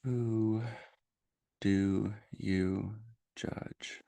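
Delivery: slow, measured meditation voice with long pauses; guided meditation. Real, not acted. Host leading listeners into noticing their judgments, guided meditation voice. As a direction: guide gently, leaving space after each phrase.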